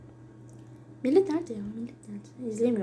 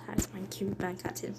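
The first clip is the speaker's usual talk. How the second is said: whisper; 4 semitones lower, 12 dB quieter than usual. Real, not acted. Whispered aside.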